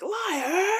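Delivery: sudden high mock yell; imitated yell. Imitating Craig abruptly yelling at Sean, high mock yell. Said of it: "like, yelling at Sean".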